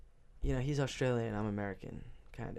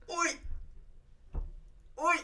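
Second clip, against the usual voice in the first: high, hammed-up Australian accent; bad Australian accent, pitched way up; 11 semitones higher, 5 dB louder, slower than usual. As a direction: shout like a cartoon Aussie hailing a mate. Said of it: "that was my Australian accent".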